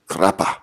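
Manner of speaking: "crappa" announced loudly with a Latin flourish; Latin pronunciation. Shown as a demonstration. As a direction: pronounce "crappa" as an emphatic Latin word. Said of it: "all the way to medieval Latin".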